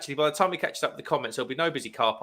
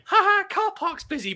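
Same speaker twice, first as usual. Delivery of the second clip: much higher mocking sing-song; mock-laughing tease pitched way up; mocking jeer. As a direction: taunt it like a playground jeer. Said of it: "Jack Roberts starts going".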